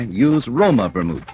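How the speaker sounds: usual speaking voice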